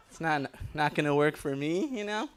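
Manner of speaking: usual speaking voice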